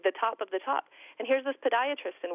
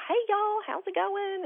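Gomer Pyle drawl; 12 semitones higher, slower than usual. Retold or imitated. Mother mocking the doctor's hokey Southern greeting. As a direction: mimic a goofy Southern country boy. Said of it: "the Gomer Pyle".

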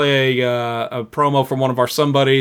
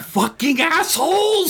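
high yelled outrage; 12 semitones higher, slower than usual. Retold or imitated. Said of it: "Goobs and Jenny Bean are like".

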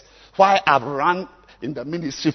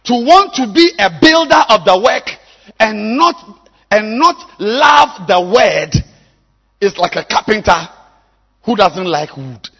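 high, loud, slow preaching shout; preaching at full force; 10 semitones higher, 17 dB louder, slower than usual. Real, not acted. Pastor rebuking leaders without Bibles, full-force preaching. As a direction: thunder it from the pulpit.

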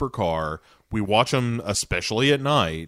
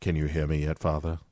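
put-on creepy kid voice; 6 semitones lower, 6 dB quieter, faster than usual. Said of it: "And then Brian says".

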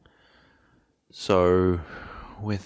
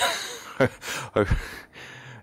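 breathy, trailing chuckle; light chuckle; 5 semitones higher than usual. Real, not acted. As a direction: laugh it off lightly. Joking about quoting off his head.

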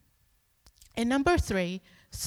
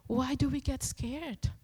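ordinary delivery

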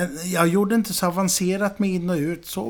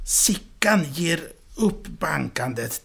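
plain reading voice

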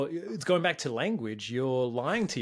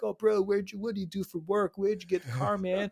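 bad, higher put-on American bro voice; mock American accent, pitched up; terrible American accent; 5 semitones higher than usual. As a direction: ham up a Yank bro admiring a car. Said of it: "terrible American accent".

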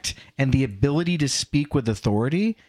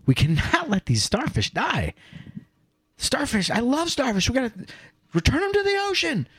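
put-on earnest little kid; 10 semitones higher than usual. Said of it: "this little kid is like".

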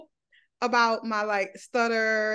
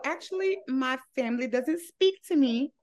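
ordinary talk

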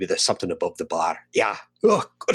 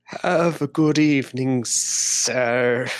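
mock gruff echo; 4 semitones higher, much slower than usual. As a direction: copy the barkeep's line back at him, hammed-up. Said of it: "said it wrong".

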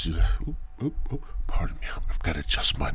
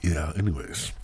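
whispered; 7 dB quieter than usual. Real, not acted. Whispered transition.

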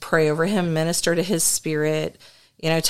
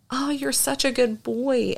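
gentle, higher voice full of praise; loving praise; 7 semitones higher than usual. Retold or imitated. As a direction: coo it to the boy like a proud parent. Praising the possessed boy lovingly.

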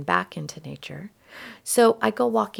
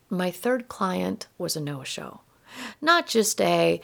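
ordinary talk

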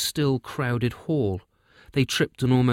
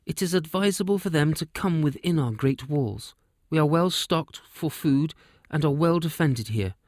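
a raised, measured royal address; commanding queen; 6 semitones higher than usual. Acted. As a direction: speak to the hall as a calm, firm queen.

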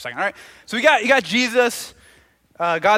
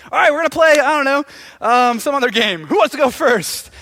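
loud, higher coach's call; announcing; 6 semitones higher, 7 dB louder than usual. Acted. PE teacher starts a game with preschoolers, loud announcing. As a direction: call it out to a group of little kids.

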